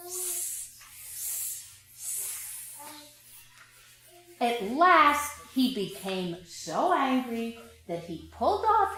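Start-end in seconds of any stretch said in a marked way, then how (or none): 0.1-2.8 whispered monkey hisses; monkey hissing. A narrator's reading. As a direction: hiss like cheeky monkeys. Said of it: "and said".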